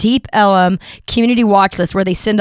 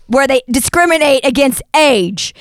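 ordinary talk

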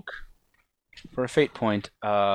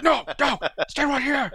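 urgent command. Acted. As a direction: call out to stop them fleeing. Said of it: "He's like".

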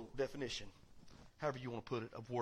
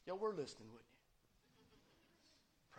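whispered teasing question; whispered aside; 12 dB quieter, much slower than usual. Real, not acted. Preacher teasing the congregation in a whisper. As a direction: whisper it slyly to the crowd.

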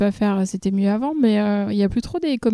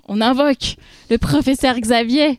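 louder, higher summoning call; mock ceremonial invocation; 5 semitones higher, 5 dB louder than usual. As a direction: summon the professor with pomp. Invocation rituelle du professeur. (invocation solennelle)

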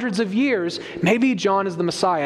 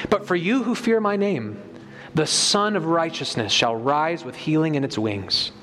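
scripture reading; 4 semitones lower than usual. Acted. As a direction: proclaim the prophecy with weight. Reads Malachi aloud.

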